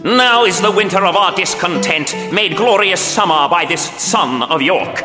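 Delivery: theatrical Shakespearean monologue; Richard the villain. Acted. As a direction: declaim like a scheming king. Richard's monologue over music.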